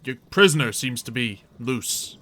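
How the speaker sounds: lightly voiced guard hailing someone; a hint of an official flagging a problem. Guard to the captor about a loose prisoner, mildly official.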